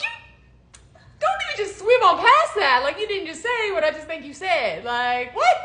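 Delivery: high, loud, laughing protest that settles toward the end; giddy shocked ranting; excited disbelief. Retold or imitated. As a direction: half-shout it through laughter. Retold disbelief at the confession.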